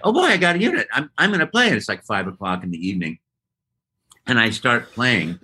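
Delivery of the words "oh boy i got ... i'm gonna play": excited. Retold or imitated. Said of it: "and I'm like".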